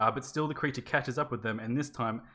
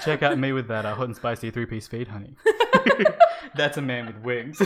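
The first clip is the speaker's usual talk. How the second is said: hammed-up American fast-food ad voice, louder; a hint of a cheesy American ad pitchman, laughing; American ad accent; 7 dB louder than usual. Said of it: "I'm terrible at the accent".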